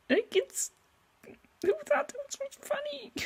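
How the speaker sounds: very high, soft squeak through laughter; helpless incredulous laughter. Real, not acted. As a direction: squeeze the question out while cracking up. Cracks up at own old joke.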